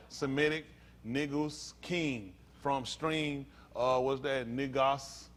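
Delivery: low, soft, halting dictionary reading; reading aloud. Real, not acted. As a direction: read an entry out haltingly, quietly. Reads dictionary entry aloud.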